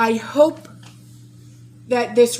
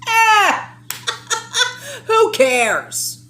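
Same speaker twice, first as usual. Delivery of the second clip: higher, louder mock shrug with a laugh; nonchalant mock shrug; 13 semitones higher, 4 dB louder, much slower than usual. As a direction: toss it off like it doesn't matter, laughing. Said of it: "so nonchalantly".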